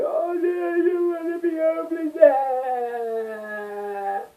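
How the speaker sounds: high whimpering wail; mock despair. Retelling his reaction to the call, a high whiny wail. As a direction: wail like a scared child. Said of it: "I was like".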